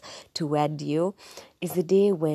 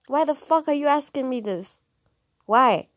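outraged; 9 semitones higher, 4 dB louder than usual. Real, not acted. Outraged at intrusive questions.

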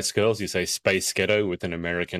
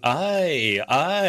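imitating Derry aye; 6 semitones higher than usual. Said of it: "started suddenly saying".

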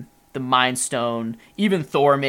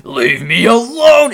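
slow, much higher and louder yell; mock yell; 11 semitones higher, 13 dB louder, slower than usual. Podcaster re-enacts Ultron yelling at Cap with a slow loud yell. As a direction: bellow it out like a furious villain. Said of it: "and he goes".

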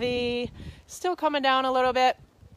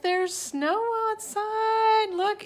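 excited; 11 semitones higher, much slower than usual. Real, not acted. Showing snow outside.

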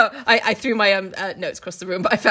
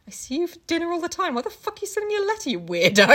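mock Lanyon; 7 semitones higher, faster than usual. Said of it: "he's like".

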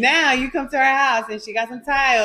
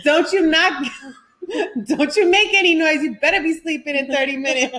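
mock strict parent; 6 semitones higher than usual. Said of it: "I'll lock him in".

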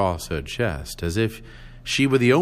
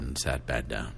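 ordinary delivery

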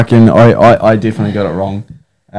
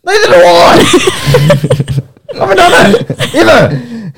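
laughing shout; 12 semitones higher, much slower than usual. Real, not acted. Laughing protest after contest.

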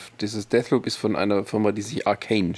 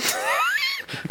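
squeals with laughter; high shrieking laugh; 4 dB quieter than usual. Real, not acted.

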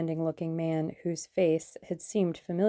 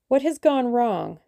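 sharp, higher and louder, a sudden threat; dangerous; 6 semitones higher, 7 dB louder than usual. Acted. Number one turning suddenly on the German, dangerous demand. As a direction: snap the question like a blade. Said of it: "Number one's voice held suddenly a dangerous quality".

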